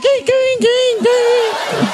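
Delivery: very high repeated whimper like a yelp; high yelping squeal. Acted. Comic voicing his frightened body part, high squeal. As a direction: squeal it like a frightened little animal. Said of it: "Meu pau viu aquilo, fala".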